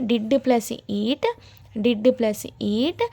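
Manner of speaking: very slow, word by word; slow spelled-out formula. Shown as a demonstration. Teacher walking learners through the formula, very slow. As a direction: lay the formula out piece by piece. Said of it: "ఇక్కడ చూడండి".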